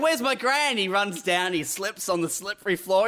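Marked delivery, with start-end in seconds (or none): 0.0-0.8 higher-pitched indignant question; mock-outraged, pitched up; alarmed demand. Acted son finds house taken over.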